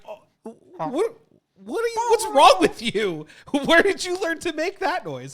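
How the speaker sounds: baffled by the cat's strange noise; mock bewildered. Owner baffled by the cat's turkey noise, high and loud. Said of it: "I'm like".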